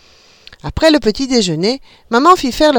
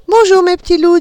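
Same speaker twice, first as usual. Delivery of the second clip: smiling greeting; 11 semitones higher, 6 dB louder than usual. A narrator's reading. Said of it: "un grand sourire aux lèvres".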